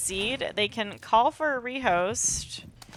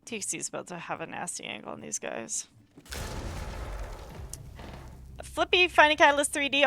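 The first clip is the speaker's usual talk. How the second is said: esports caster; 5 dB louder, faster than usual. Acted. Live esports match commentary.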